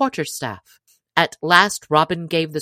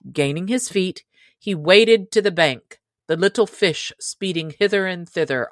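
ordinary delivery, nothing marked

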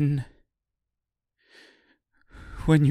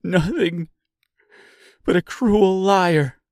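high, loud, angry cry; raised, anguished accusation; screaming; 8 semitones higher, 5 dB louder than usual. A narrator's reading. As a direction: hurl it out in distress. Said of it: "screamed the seamstress".

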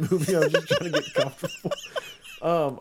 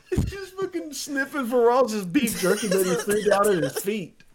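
ordinary delivery

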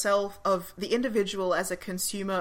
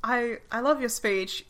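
ordinary talk

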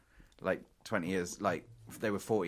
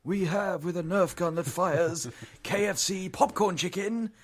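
much higher, louder, slow fight announcer call; ring announcer; 10 semitones higher, 6 dB louder, slower than usual. Acted. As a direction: hype up the red corner like a boxing MC.

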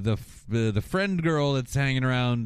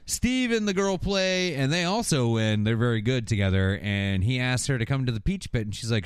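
ordinary talk, nothing marked

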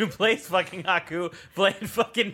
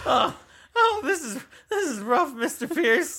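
high, whiny character voice; Morty; 11 semitones higher than usual. Acted. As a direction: complain pitifully way up high, half laughing. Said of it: "It's Morty now".

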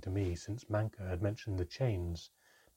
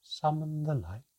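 ordinary delivery

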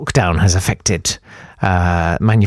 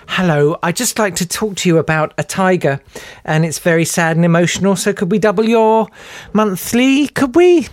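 mock sweet charity caller rising higher; Greenpeace caller; 8 semitones higher than usual. Mocking Greenpeace phone caller, high sugary wheedle. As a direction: wheedle higher and higher like a pushy fundraiser. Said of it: "they don't talk like that".

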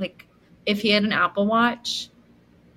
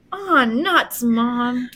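put-on whiny teen; 3 semitones higher, much slower than usual. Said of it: "Julie goes".

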